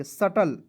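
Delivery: सटल with the b silent, "sut-al"; correct pronunciation. Shown as a demonstration. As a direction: drop the b and say सटल. (सही उच्चारण: सटल)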